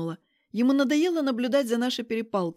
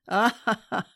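short voiced ha-ha-ha; acted male laugh; slower than usual. A narrator's reading. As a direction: laugh aloud, amused, as a character. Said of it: "Его смех такой заразительный".

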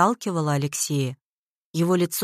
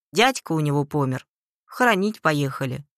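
ordinary delivery, nothing marked

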